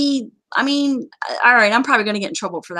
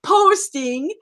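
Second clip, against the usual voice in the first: drawn-out high STOP, then slow emphasis; exasperated plea; 5 semitones higher, slower than usual. Acted. Host pleading with players posting in forums, exasperated. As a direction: beg them, stretching the words out.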